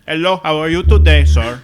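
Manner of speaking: put-on very loud, high Indian accent; mock Indian accent. Mimicking the scam caller, loud mock Indian accent. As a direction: shout it in an exaggerated Indian accent. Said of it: "imitating his accent".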